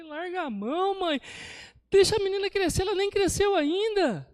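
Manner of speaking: high, loud retold outburst, building; exasperated shouting. Preacher recounts scolding a mother fretting over her baby, exasperated shout.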